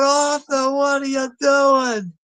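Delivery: high, panicked yell, drawn out slowly; mock panic, shouted high and slow; freaking-out shout. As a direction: yell in alarm at a friend gone mad. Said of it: "Gary's freaking out".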